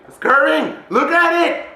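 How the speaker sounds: high, loud exclamation; excited. Real, not acted. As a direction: burst out, pitch climbing in amazement. Repeats it, getting carried away.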